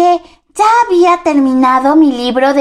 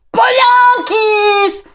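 slow, drawn-out call; friend calling out; 3 semitones higher, much slower than usual. Retold or imitated. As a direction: call her name across the lake. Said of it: "Era mi amigo Juanito".

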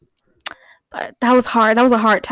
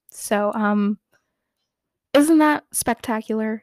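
normal talking voice

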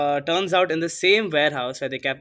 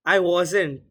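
short, louder, higher protest; insistent denial; 4 semitones higher, 5 dB louder than usual. Real, not acted. Host insisting to doubtful co-host, defensive. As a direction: insist on your innocence.

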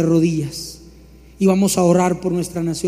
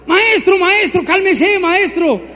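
mock pleading disciple; 9 semitones higher, slower than usual. Preacher playing a disciple begging Jesus to calm down, high and pleading. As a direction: beg the master to calm down.

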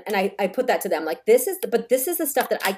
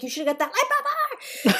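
exasperated mock yell; 13 semitones higher than usual. Mother retelling her reaction to the doctor, exasperated. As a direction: protest in a much higher voice.

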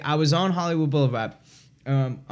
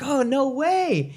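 high, drawn-out thrilled reply; slow, pitched-up astonishment; mock amazement; 11 semitones higher, slower than usual. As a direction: imitate a delighted new friend. Acts out the believer's delighted reply.

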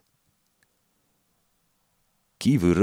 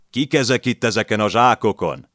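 loud, higher barked question; shouting detective; 7 semitones higher, 6 dB louder than usual. A narrator's reading. Detective shouts at the landlady, loud and higher. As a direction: yell at the old woman. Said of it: "A detektív ordított".

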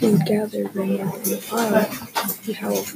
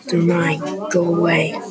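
low, louder grumble; a possessive pug's grumble; grumbling; 4 semitones lower, 4 dB louder than usual. Acted. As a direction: grumble it like a grumpy pug. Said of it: "Pig would just grumble".

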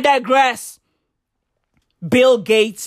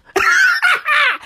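shouted burst of laughter; loud high laugh; 4 dB louder than usual. Real, not acted. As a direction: cackle at your own joke. Laughs at own joke.

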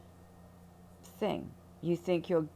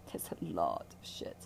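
lower whispered repeat; 13 semitones lower, 5 dB quieter than usual. Real, not acted. Healer repeating her aside to the listener, hushed lower whisper.

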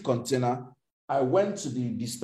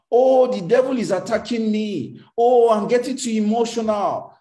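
mocking a fretful believer; 6 semitones higher, 4 dB louder than usual. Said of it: "some of you just say".

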